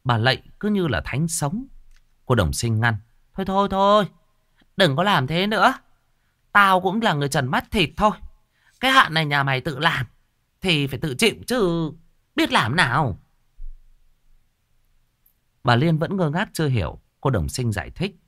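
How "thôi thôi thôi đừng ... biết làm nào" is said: stern shaman. Acted. Shaman warning the old woman of ghosts, high and bossy. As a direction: talk down to the client.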